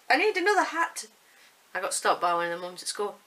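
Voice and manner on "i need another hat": put-on high, loud whiny kid demand; kids' voice. Mother imitating her boys demanding another hat. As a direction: mimic a boy begging loudly. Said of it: "The boys would be like".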